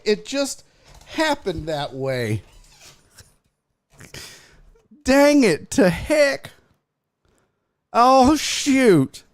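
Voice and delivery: high, hammed-up oopsy voice; feigned innocent dismay. Streamer pretending a shirt mishap was accidental, mock dismay. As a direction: badly fake an accident, pitched high.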